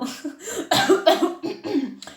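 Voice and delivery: high, loaded cough; fake coughing fit. YouTuber chokes on insincere praise, fake coughing fit. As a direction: cough as if allergic to your own lie. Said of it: "je suis allergique quand je dis des choses que".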